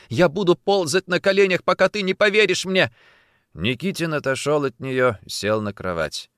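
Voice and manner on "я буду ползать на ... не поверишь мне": high, loud, tearful vow; crying plea. A narrator's reading. Kneeling woman begs to be believed, crying. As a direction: wail it, begging belief. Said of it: "плакала она".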